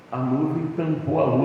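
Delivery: ordinary delivery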